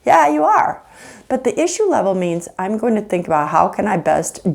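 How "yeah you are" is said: high rising "you are" with a knowing lilt; knowing retort. Acted. Wife calls out husband's fake calm, teasing. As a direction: call their bluff, teasingly.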